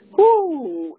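high joyful whoo; excited whoo. Real, not acted. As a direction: cry out with joy. Excited exclamation over revelations.

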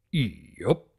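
low, short farm-pony yup; terse low grunt of agreement; lightly voiced stoic stallion; Big Mac drawl. A narrator's reading.

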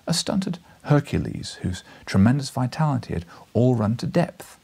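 usual speaking voice

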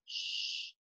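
very soft unvoiced shush. Shown as a demonstration. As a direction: hush them like a librarian. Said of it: "the rule is you must be quiet".

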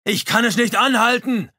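high, loud yell across the river; shouting back. A narrator's reading. Chaboneau shouts back from the drifting canoe, loud high yell. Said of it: "schrie der zurück".

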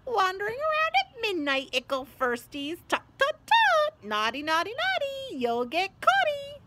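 very high, loud sing-song mockery; taunting poltergeist. A narrator's reading. Peeves to first-years caught at midnight, gleeful taunting. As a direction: tease gleefully like a naughty imp.

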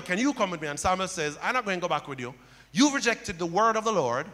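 ordinary talk, nothing marked

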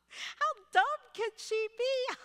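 high, jeering taunt; mocking teens. Kids in the back seat mock the crying teacher, high and jeering. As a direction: ridicule her like snickering teenagers. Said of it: "are laughing hysterically".

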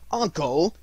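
imploring call to a relative; pleading. A narrator's reading. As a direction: plead with him. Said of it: "pleaded the nephew".

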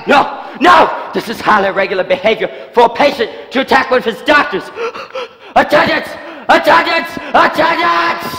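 high, loud frantic yells; rising panicked cries for the attendants; panicked shouting. Acted. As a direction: scream for help in a panic. Patient attacks, doctor calls attendants.

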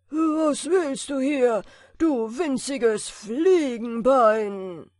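loud, slow booming giant voice; thundering giant. A narrator's reading. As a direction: boom it out like thunder. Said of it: "mit einer Stimme, als wenn's von allen Seiten donnerte". (donnernde Riesenstimme)

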